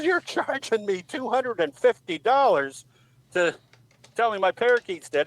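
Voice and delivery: high-pitched, incredulous lady; indignant customer. Acted. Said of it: "She goes".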